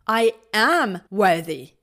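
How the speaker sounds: loud, slow, fiery declaration; burn with conviction. Shown as a demonstration. Coach showing a fiery way to claim worth, loud. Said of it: "Maybe more fiery".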